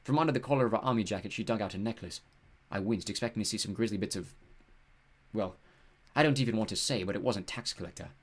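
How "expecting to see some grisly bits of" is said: plain reading voice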